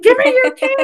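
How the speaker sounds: high, loud, gleeful burst; playful eager demand. Retold or imitated. Swim teacher to exhausted new parents, playful eager demand. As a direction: squeal it with mock urgency, nearly laughing.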